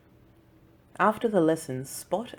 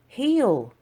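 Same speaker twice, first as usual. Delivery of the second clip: firm command; 8 semitones higher, 7 dB louder than usual. Acted. Boy commanding dog on walk.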